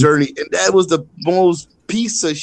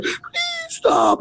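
begging bully; 3 dB quieter than usual. Retold or imitated. Beaten bully begging on floor.

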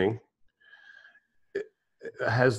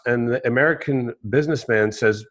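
usual speaking voice

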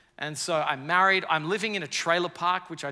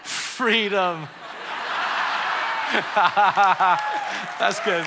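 laughing; 5 semitones higher, 9 dB louder, much slower than usual. Real, not acted. Crowd laughter, loud reaction.

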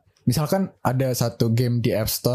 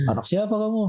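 higher-pitched retold demand to a child; questioning adult; 8 semitones higher than usual. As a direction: imitate an adult questioning a kid. Adult interrogates child in retold story.

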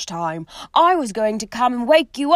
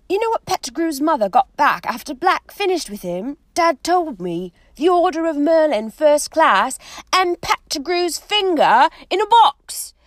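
loud warning; 8 semitones higher, 7 dB louder than usual. Acted. Ron warning, loud and high.

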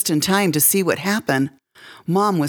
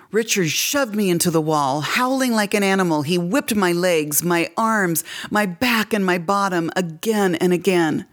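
ordinary delivery

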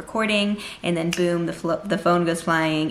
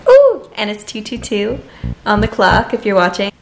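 high, loud delighted ooh; light up at the clock; excited; 3 semitones higher, 10 dB louder than usual. Real, not acted. Noticing 2:22 on the clock.

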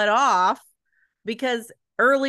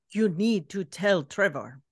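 a lightly voiced Edgar, mimicking the audiobook reader; 3 semitones lower than usual. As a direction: copy the narrator's take on Edgar, lightly. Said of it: "that's how my reader made him sound".

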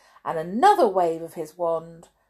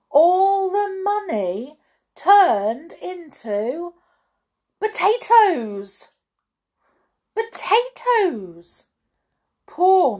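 high, loud, slow storyteller's astonishment; dramatic reveal; 12 semitones higher, 8 dB louder, slower than usual. A narrator's reading. Storyteller revealing the wizard's spell on the money, amazed. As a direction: draw it out, pitched right up for the climax. Narrator's climax of the magic trick.

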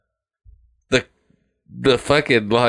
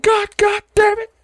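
high-pitched mock cursing; dad in traffic. Dad cursing in traffic, high mock outburst. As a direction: imitate an exasperated dad, pitched way up. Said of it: "the dad's in traffic".

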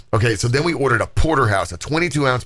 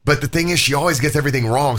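ordinary talk

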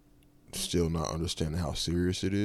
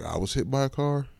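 surprised; 8 semitones higher, 6 dB louder than usual. Retold or imitated. Learning he was hit.

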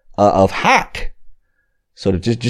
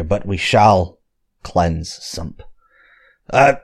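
normal talking voice

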